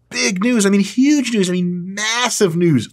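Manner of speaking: high, excited build from big to massive; hyped announcement. Real, not acted. Host teasing big news to followers, hyped. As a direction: stretch MASSIVE out slowly as the hype builds.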